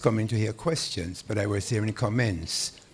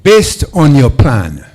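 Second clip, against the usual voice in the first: very loud, slow and higher-pitched; speaking up; 4 semitones higher, 18 dB louder, slower than usual. Real, not acted. Audience member repeating a question after being asked to speak up, very loud. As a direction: project each word slowly to the back. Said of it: "speak up".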